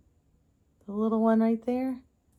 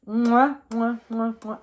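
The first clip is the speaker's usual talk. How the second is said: kiss sounds; slower than usual. Real, not acted. Kissing the crystal.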